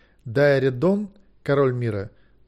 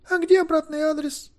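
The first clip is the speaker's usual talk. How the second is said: put-on very high girlish question; anxious girl; 3 dB louder than usual. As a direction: ask urgently in a high little voice. Girl asks.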